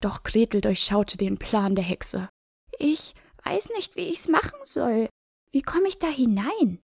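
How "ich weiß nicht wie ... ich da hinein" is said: high, hushed feigned innocence; little girl voice. A narrator's reading. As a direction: play dumb, timid and small. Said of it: "fragte sie die Hexe".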